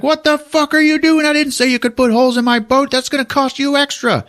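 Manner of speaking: Treat's outraged whine. Retold or imitated. Treat scolding the mercs about his boat, high mock outrage. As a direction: screech like an indignant boat owner. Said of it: "Treat's like".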